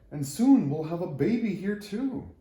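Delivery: thrilled little tree; excited. Acted. Tree thinking about the coming baby, excited and bright. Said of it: "was all excited by their visit".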